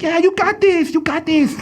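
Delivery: high, over-the-top cheerleader voice; goofy cheering Hitler. Retold or imitated. Imaginary friend Hitler cheering Jojo on, goofy high cheer. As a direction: cheer him on like a silly hype man.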